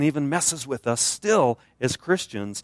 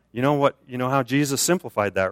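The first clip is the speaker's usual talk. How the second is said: ordinary talk, nothing marked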